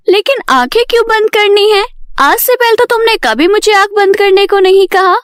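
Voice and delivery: high-pitched, questioning customer; puzzled Payal. Acted. Payal doubts the hairstylist's request to close her eyes, puzzled.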